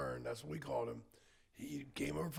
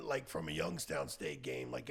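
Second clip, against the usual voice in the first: ordinary delivery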